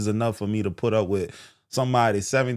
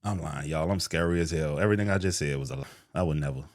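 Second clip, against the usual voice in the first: much softer, quick admitting it was a joke; sheepish quiet confession; 7 semitones lower, 6 dB quieter, faster than usual. Real, not acted. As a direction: drop the act and own up softly. Sheepish confession after bravado.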